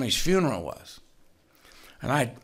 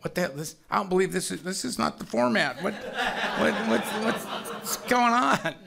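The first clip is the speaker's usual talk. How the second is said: higher-pitched, stammering confusion; mock bewilderment; 8 semitones higher than usual. Whole meeting looks around at the crying woman, mock bewilderment. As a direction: imitate a baffled group, voice pitched up. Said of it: "everybody's just kinda looking around like".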